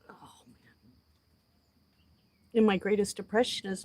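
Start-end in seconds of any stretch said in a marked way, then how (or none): 0.1-0.7 breathy whispered sigh. Real, not acted. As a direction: let it out under your breath. Overcome, unvoiced sigh.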